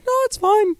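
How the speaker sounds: very high, squeaky denial. Acted. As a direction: insist in a fake tiny falsetto. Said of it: "hits me with that high voice".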